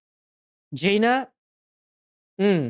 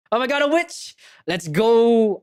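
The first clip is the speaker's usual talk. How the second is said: ordinary delivery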